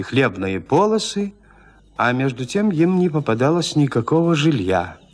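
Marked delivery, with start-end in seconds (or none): none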